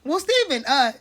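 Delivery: high, mock questioning voice; imitating Nick. Mocking Nick asking Steven, high mock question. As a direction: raise the pitch and ask it lightly. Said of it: "Nick said".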